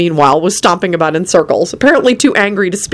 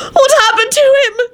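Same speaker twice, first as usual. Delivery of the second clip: very high, distressed wail; frantic wail. A narrator's reading. Elizabeth seeing unconscious Lupin carried in, wailing. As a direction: wail it in a panic, voice way up. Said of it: "she wailed".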